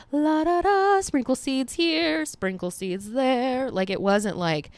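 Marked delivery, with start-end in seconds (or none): 0.1-3.7 careless singsong. Retold or imitated. Mocking careless planting.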